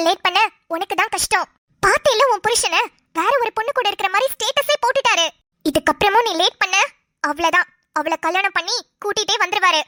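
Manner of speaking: scheming friend Roja. Acted. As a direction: push her to go home now, low and insistent. Second character Roja advises.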